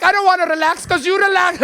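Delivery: imitated mom's indignant comeback; angry mother. Comic predicts mother's comeback to her son, high accented scolding. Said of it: "she's gonna be like".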